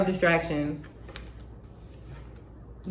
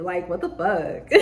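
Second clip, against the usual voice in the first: slow, softer bewildered aside; odd excited voice; 2 semitones lower, 6 dB quieter, slower than usual. Real, not acted. Reader baffled by own excitement, drawn-out bewilderment. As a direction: draw it out under the voice, puzzled. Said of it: "why am I talking like that".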